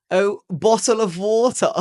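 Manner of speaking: put-on high, loud, slow parody of an English accent; mock English accent. American girls copy his speech, mock English accent. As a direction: imitate girls gleefully aping an English accent. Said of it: "like, an English accent".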